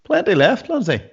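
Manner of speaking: high, wheedling coax. Acted. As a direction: coax her sweetly into a drink. Coaxing Lindsay to drink.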